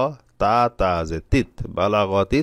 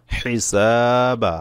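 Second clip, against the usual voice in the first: drawn out; 3 semitones higher, much slower than usual. Shown as a demonstration. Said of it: "spelling out the word".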